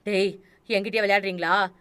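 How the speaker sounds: loud angry challenge. Acted. Old man furious at whoever is scratching, loud angry yell. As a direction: yell at the pranksters behind the door. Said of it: "Ramalingam ஆத்திரமானார்".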